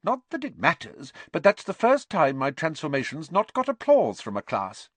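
high, brisk, affronted teacher; crisp professor voice, a touch put out; peeved schoolmistress. Acted. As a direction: complain to the class with wounded dignity.

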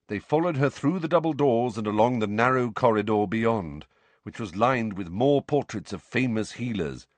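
ordinary delivery

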